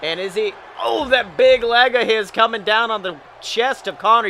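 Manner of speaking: excited ringside play-by-play; wrestling commentator. Acted. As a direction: call the match live, hyped.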